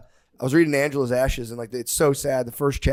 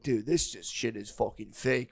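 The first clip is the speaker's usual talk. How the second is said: stoned; 9 dB quieter, slower than usual. Retold or imitated. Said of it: "very stoned all the time".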